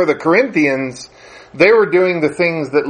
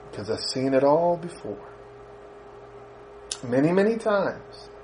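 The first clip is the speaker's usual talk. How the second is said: much softer, calm and certain; knowing mother; 12 dB quieter than usual. Retold or imitated. Mother answering her son, soft and knowing. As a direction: let the wisdom settle quietly. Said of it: "mother's calm answer".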